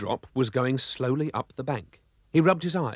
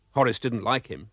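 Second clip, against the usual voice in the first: usual speaking voice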